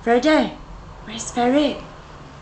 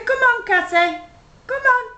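coaxing call; 8 semitones higher, faster than usual. Shown as a demonstration.

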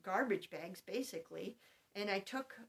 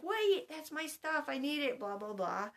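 put-on whiny child; 6 semitones higher, 4 dB louder than usual. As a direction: whine it like a kid whose toys are gone. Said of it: "And they were like".

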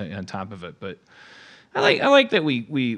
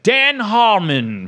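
loud, very slow, higher mock announcement; mock loud proclamation; 9 semitones higher, 7 dB louder, much slower than usual. Naming his mentor in an interview, hammed-up loud slow boast. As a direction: blare the name out like a big reveal. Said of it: "in that accent or at that volume".

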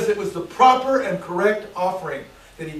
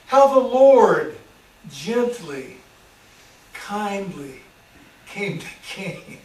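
gentle fading; 4 dB louder, much slower than usual. Acted. Gentle God contrasted with anger.

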